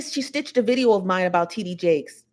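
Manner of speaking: usual speaking voice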